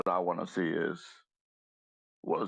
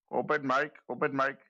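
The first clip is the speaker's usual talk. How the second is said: higher, louder Indian accent attempt; 5 semitones higher, 4 dB louder than usual. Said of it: "Is that your best, uh, Indian accent".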